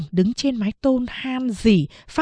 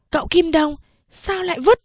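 put-on high, whiny child protest; a boy grumbling at his master's order; discontented; 8 semitones higher than usual. Boy questioning his master, high and discontented. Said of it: "giọng bất mãn".

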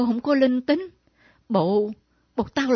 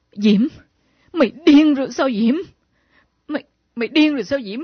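shocked scolding; 3 semitones higher, 4 dB louder than usual. Acted.

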